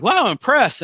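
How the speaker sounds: an impressed wow. Retold or imitated. Young agent reacting to his listing, impressed. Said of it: "He's like".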